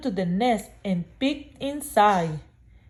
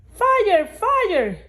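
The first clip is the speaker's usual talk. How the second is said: crying fire; 10 semitones higher than usual. Acted. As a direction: yell it to scare the birds off. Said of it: "he cried".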